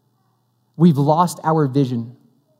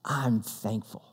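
very low, hushed sincerity; breathed-out gratitude; 8 semitones lower, 15 dB quieter, much slower than usual. Retold or imitated. Clerk answering a customer, quietly heartfelt. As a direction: let it out softly after a deep breath. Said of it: "takes a deep breath and says".

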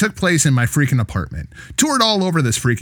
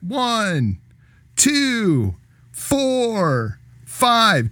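raised-pitch referee counting, skipping three; 8 semitones higher, much slower than usual. Retold or imitated. Referee counting out, skipping three, lightly voiced higher count. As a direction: call the count like a ring referee. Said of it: "he went".

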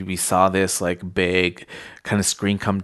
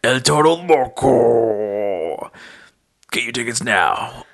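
slow booming hyped ad announcer; monster truck voice; 4 semitones higher, 4 dB louder, slower than usual. Acted. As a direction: stretch every word like a monster truck radio ad. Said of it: "making my monster truck voice".

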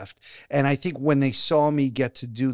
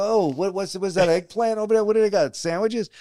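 higher-pitched, breezy retold chatter; mock oblivious star; 7 semitones higher than usual. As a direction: act too busy eyeing the food to notice. Acted snub at the commissary.

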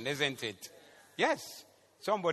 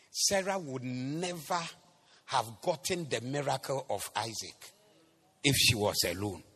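ordinary talk

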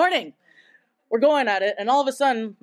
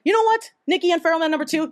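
mischievous suggestion; 6 semitones higher, faster than usual. Retold or imitated. Friend's cheeky push to make out.

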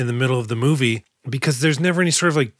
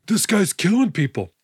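higher, hint of a frightened whine with a gasp; panicked accomplice; 6 semitones higher than usual. Mister Green protesting to the gang, mildly panicked. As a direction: plead as if things got out of hand. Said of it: "When Mister Green is like".

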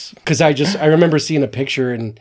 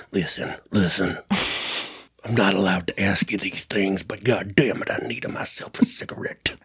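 imitated hushed confiding voice, lower and much softer; conspiratorial lean-in; 5 semitones lower, 6 dB quieter than usual. Actor leans in to beg a stranger for a cigarette, hushed and secretive. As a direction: lean in close and let it sink toward a whisper. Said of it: "he seems like the type that would lean in like".

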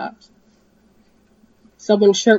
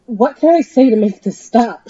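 a higher, louder desperate plea; pleading; 5 semitones higher, 9 dB louder than usual. Acted. Dee pleads with the masked pirates, higher and desperate. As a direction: beg them to stop. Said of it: "she pleaded".

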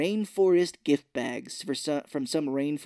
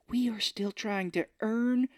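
high, slow, earnest mock protest; Edward Norton; 10 semitones higher, slower than usual. Squeaky clean man objects in the board meeting, mock earnest. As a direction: draw it out as a squeaky clean idealist.

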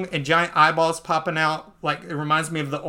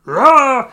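loud, raised mock roar; dragon roar; 7 semitones higher, 10 dB louder than usual. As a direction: let out a playful dragon roar. Imitating the dragon eating Luffy.

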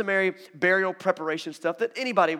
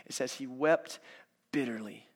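usual speaking voice

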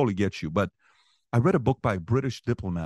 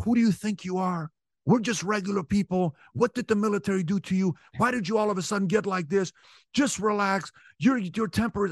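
raised, higher-pitched retold confrontation; heated argument; 11 semitones higher than usual. Son confronting his father in the car, pitched high. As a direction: press hard, voice climbing in the argument.

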